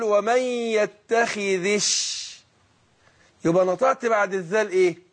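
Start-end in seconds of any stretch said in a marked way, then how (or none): 0.0-2.3 ومن يتخذش with the dhal kasra running into the shin, very slow; connected reading. Shown as a demonstration. Teacher to student showing the linked يتخذ الشيطان. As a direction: link يتخذ straight into الشيطان's shin, drawn out. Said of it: "فما أجي أقول".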